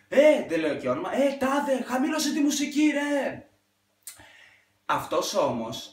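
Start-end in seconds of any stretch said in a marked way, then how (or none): 0.1-3.4 high, loud call across the street; shouting at neighbor. Retold or imitated. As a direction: yell it over the music. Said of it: "αρχίζει και του φωνάζει". (φωνάζει στον γείτονα να χαμηλώσει τη μουσική)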